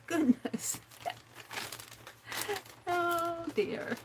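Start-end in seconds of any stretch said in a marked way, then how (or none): none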